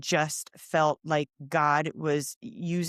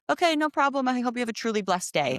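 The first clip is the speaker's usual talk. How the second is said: higher, gentle blessing for each passerby; a bright, sincere well-wishing stranger; lightly voiced friendly stranger; kind stranger's blessing; 7 semitones higher than usual. Man blessing shoppers.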